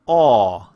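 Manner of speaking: loud "aw" through a tight oval; rounded aw vowel. Shown as a demonstration. Teacher demonstrates the tight oval lips, loud rounded "aw". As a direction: project a resonant, rounded "aw". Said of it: "creating a really tight oval".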